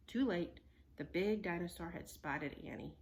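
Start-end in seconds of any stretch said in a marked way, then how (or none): none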